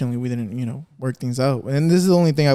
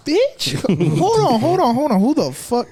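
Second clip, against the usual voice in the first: mock outrage; 11 semitones higher than usual. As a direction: play up the disbelief, voice jumping high. His retold outraged reply.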